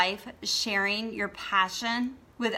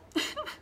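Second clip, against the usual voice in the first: teary laugh; 5 dB quieter than usual. Real, not acted. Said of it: "I'm getting emotional".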